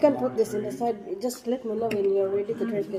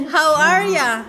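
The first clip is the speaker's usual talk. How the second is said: mock Australian; 4 semitones higher, 7 dB louder, slower than usual. Shown as a demonstration. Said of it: "I'm trying to be an Australian slang".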